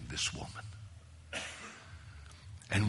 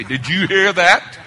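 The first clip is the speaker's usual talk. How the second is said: loud pointed nudge, pitched up; mock nudging wife; 7 semitones higher, 15 dB louder than usual. Preacher mimicking a wife nudging her husband, loud. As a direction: jab it like an elbow in the ribs.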